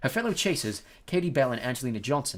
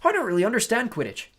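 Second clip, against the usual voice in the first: breathless; 9 semitones higher, 7 dB louder than usual. Acted. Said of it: "said Colin breathlessly".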